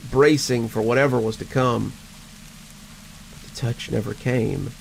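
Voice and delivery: ordinary delivery, nothing marked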